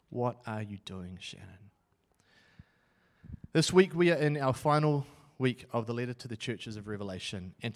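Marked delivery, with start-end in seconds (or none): 0.1-1.7 softer and lower, turned inward; quiet echo. Retold or imitated. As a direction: let the question sink in quietly. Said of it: "because I wanted them to see me chase glory".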